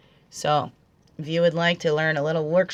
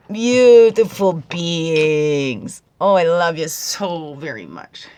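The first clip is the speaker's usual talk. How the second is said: slow, loud, drawn-out endearment; slow, fond greeting to the viewers; loving address; 8 dB louder, much slower than usual. Real, not acted. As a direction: embrace the audience with every stretched word.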